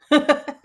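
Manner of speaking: laughing at her own mix-up. Real, not acted. Laughing at herself for tripping over numbers, a burst of laughter. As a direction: burst of high, loud laughter.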